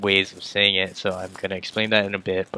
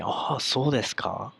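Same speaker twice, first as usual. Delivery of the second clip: higher-pitched, intrigued reaction; demonstrating a mildly surprised "oh, is that so?"; surprised interest; 5 semitones higher, 4 dB quieter than usual. Shown as a demonstration. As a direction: react to interesting news, acting it out. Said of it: "someone says something surprising or something interesting".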